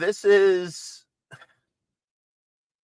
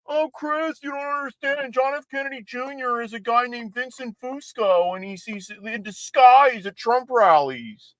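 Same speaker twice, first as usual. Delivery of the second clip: high, sneering mock voice; mocking conspiracy theorists; 8 semitones higher than usual. Host mocking QAnon fans in a high, sneering voice. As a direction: parody the believers as gullible. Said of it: "All these QAnon morons".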